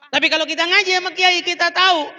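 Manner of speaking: high, ringing sermon emphasis; preacher lifting the pitch; impassioned preaching. Real, not acted. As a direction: raise it up to drive the point home. Preaches with raised voice.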